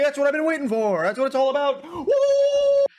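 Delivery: very high, loud and fast, ending in a whoop; unhinged hype yell; Moist Critical hype. Acted. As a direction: keep screaming it like a streamer going wild. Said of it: "Moist Critical saying".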